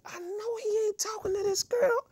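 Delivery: squeaky high mock voice of stunned friends; mock disbelief. Friends staring from other aisles, mock shocked squeal. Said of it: "looking like".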